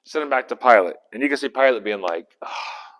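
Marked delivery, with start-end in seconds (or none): 2.4-2.9 exasperated breathy sigh; weary sigh. Acted. Pilate getting Jesus sent back to him, sighing wearily. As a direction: let out a soft, put-upon sigh.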